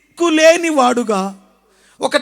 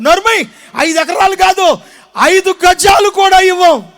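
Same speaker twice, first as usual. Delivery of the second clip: brothers snapping back, shouting him down; angry shout; 5 semitones higher, 6 dB louder than usual. Retold or imitated. Brothers shouting at the elder brother, angry refusal. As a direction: shout them off, furious.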